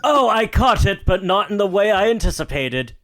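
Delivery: loud, high, mock-polite quip; hammed-up dying son. Son speared in the chest, loud and cheerful. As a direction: boom it out like a cheerful victim. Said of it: "It lands in Zagreus' chest".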